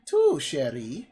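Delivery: put-on slow French accent, leaping high. Disguised woman explaining the alternate world, mock French accent. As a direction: stretch it out in a cartoon French accent. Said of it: "my voice just goes from French stereotype".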